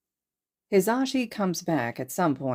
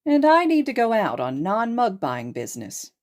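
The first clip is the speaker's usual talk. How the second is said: loud cheerful announcement; 6 semitones higher, 5 dB louder than usual. Acted. Hizashi loudly announcing.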